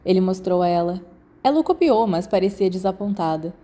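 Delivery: plain reading voice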